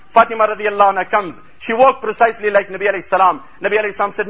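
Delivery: usual speaking voice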